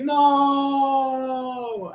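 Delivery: high, long drawn-out cry; hammed-up cry of despair; mock wail. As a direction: wail it out, holding the vowel. Voices Allison as she loses.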